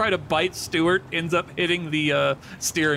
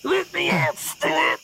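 high muffled cry from inside a car; muffled snake voice; 12 semitones higher, slower than usual. Acted. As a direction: shout it through glass, high and muffled. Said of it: "muffled voice of Macaroni".

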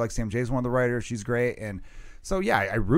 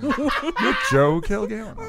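announcer voice; 8 semitones higher, much slower than usual. Acted. Mock billing for the matinee show.

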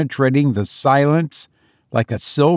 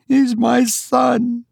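high, drawn-out wife's protest; crying out; 12 semitones higher, slower than usual. A narrator's reading. Mother insisting on seeing her son, crying out. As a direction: plead it slowly, pitched very high. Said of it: "she cried".

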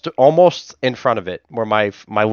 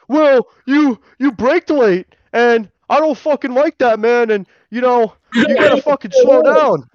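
higher-pitched mocking voice of the angry pedestrian; 12 semitones higher, 4 dB louder than usual. Friend imitating the man scolding her for braking late, higher mock voice. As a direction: parody the guy, pitch pushed up. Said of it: "and he goes".

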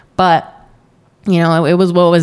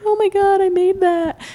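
very high-pitched, hammed-up weepy squeal; squeaky pretend sobbing, overcome with joy; mock happy crying; 13 semitones higher than usual. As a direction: imitate a new mom blubbering with happy tears. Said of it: "crying every time you see your baby".

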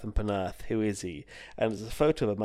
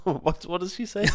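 ordinary talk, nothing marked

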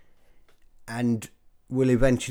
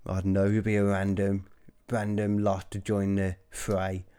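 ordinary talk

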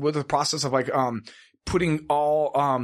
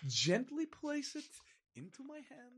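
much softer, higher and slow; slow, soft, higher hammed-up pampered plea; mock dainty hush; 8 semitones higher, 15 dB quieter, slower than usual. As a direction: breathe it out delicately. Pampered shopper's dainty request.

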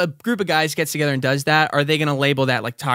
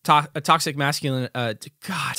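usual speaking voice

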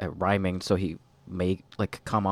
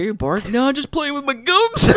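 mocking; 7 dB louder than usual. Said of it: "It's like".

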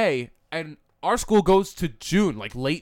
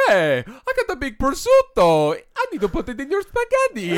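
Mario; 5 semitones higher than usual. Pretend. Flirt leaving an Instagram voice message, Mario voice. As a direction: draw out a silly game-plumber come-on. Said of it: "with the Mario voice".

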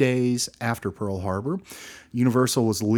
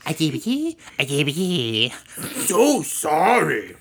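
high, loud Sandler goofy voice; Adam Sandler impression; 9 semitones higher, 8 dB louder than usual. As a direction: do Adam Sandler's silly singsong. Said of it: "Do an Adam Sandler impression".

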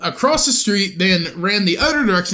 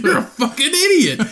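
laughing insult; 11 semitones higher than usual. Retold or imitated. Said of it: "And I was like".

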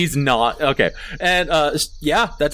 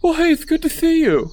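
very high, lisping little kobold; high-pitched gummy kobold voice; toothless kobold; 14 semitones higher than usual. Acted. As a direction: squeak it out through missing teeth.

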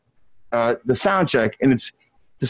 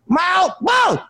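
yelped much higher, a mock pained shout; retold shout. Singer zapped by the mic yelling at sound check. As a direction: yelp it like the mic just shocked you.

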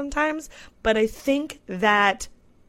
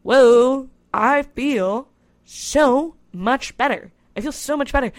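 higher and louder, drawn out with relief; a big happy whoa, slow and stretched; suddenly feeling better after the sauna, elated; relieved delight; 5 semitones higher, 7 dB louder, slower than usual. Retold or imitated. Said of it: "I'm like".

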